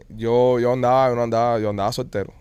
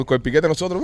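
usual speaking voice

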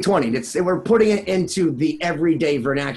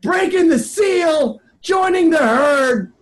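shouted, high announcer hype; hype intro; 7 semitones higher, slower than usual. Acted. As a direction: whip up the crowd with a big intro. Hyped host introducing a guest.

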